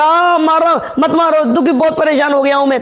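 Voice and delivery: high-pitched mock pleading; hurried, high cry of someone being beaten, hammed up; acted beating victim. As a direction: plead like a victim begging for mercy, lightly acted. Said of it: "बोलेगा".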